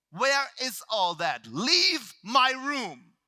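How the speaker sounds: slow, very loud dismissal; angry yell. Retold or imitated. Trainer throwing the speaker out of the seminar, angry yell. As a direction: throw them out with drawn-out, high-pitched force. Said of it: "He yells at me".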